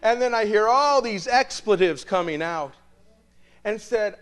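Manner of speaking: ordinary talk, nothing marked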